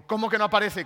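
alarmed question. Retold or imitated. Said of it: "en ese tono me asusté más".

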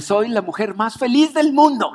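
gushing mock delight; happy shop lady. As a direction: imitate the cashier bursting with happiness. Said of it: "Y me dice".

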